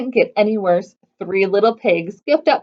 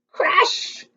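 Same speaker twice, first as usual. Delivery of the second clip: usual speaking voice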